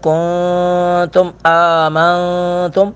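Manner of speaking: کنتم آمنتم with the noon hidden and nasal, drawn out; ikhfa demo. Shown as a demonstration. Teacher showing ikhfa to students, slow nasal chant. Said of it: "حروف اخفا میں سے ہے".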